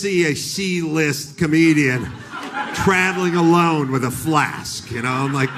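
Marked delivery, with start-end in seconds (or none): none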